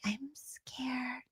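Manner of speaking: tiny breath of mock fear; mock-timid, barely voiced whisper; imitating scared whisper. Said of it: "does everything terrify her".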